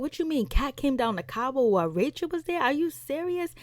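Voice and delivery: high, incredulous retold questions; shocked disbelief. Recapping the show, imitating Van's shocked disbelief. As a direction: play it as the friend who can't believe the news. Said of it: "He was like".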